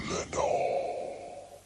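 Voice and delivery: faint whispered echo; echo murmur. Acted. The darkness echoing the name back to him, faint whispered echo. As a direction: let it come back like a distant echo. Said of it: "an echo murmured back the word".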